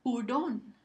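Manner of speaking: "Hurón" with a strong final syllable; stress on RÓN. Shown as a demonstration. Teacher giving students a word to judge, stress on RÓN in "Hurón". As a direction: punch RÓN at the end of "Hurón". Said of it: "Es la última sílaba".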